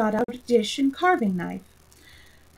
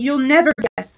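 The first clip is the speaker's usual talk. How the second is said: loud eager unicorn call; eager cry; 4 semitones higher, 6 dB louder than usual. A narrator's reading. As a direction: burst out, bursting to tell. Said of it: "the unicorn cried eagerly".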